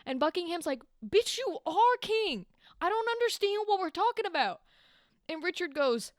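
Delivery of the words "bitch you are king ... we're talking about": exasperated outburst. Retold or imitated. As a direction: snap at a dim friend in disbelief. Exasperated Buckingham retold.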